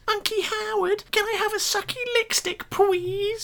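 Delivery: put-on very high, sweet little-girl voice; silly high-pitched child. Mocking Howard's made-up niece Katie, squeaky child voice. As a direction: beg like a tiny niece. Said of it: "doing a silly high-pitched voice".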